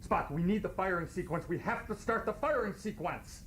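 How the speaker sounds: high, urgent pleading; urgent Kirk. Acted. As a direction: beg him before it blows. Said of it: "I'm gonna do Kirk".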